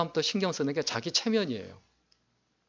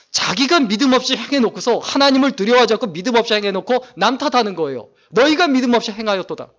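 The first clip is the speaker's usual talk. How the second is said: louder, higher preaching push on 자기가 and 남 탓; forceful preaching; 6 semitones higher, 6 dB louder than usual. Acted. Preacher rebukes Saul's blame-shifting to the congregation, forceful. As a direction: drive the rebuke home from the pulpit.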